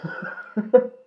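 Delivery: high-pitched burst of laughter; squeaky amused laugh; high giggle. Real, not acted. Laughs at own joke.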